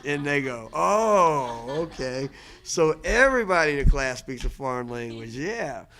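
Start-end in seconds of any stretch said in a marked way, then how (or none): 0.7-2.3 a loud, drawn-out dawning "Oh"; surprised oh. Retold or imitated. Kids in class realizing English is foreign, surprised.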